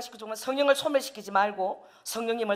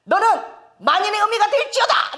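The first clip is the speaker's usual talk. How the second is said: high, raised mock-prophetic declaration; imitated proclamation; 10 semitones higher, 4 dB louder than usual. Imitating a child prophesying to mother, high proclamation. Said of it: "선포를 하더라고".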